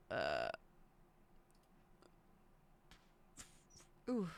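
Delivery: a hiccup cuts the words. Real, not acted. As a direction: caught by a hiccup. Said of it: "man, I got the hiccups".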